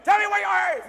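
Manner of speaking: imitating a little kid screaming a demand at the speaker. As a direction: yell it like a pushy fifth grader. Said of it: "He's screaming at me".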